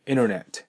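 "インターネット" as innernet, no T after N; American dropped T. Shown as a demonstration. Teacher repeats American English, "インターネット" without middle T. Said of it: "アメリカ英語ですね".

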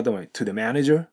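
"to the manager" slowly climbing at the end; teacher's model of "to the manager" with rising intonation; rising question tune. Shown as a demonstration. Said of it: "尻上がりにしていくと".

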